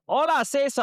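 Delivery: mock Spanish greeting. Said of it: "people will say".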